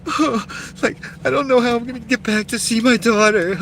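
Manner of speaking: high, whiny fake-tearful plea; imitating an older woman crying about her daughter; mock crying woman. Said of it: "She was, like, crying".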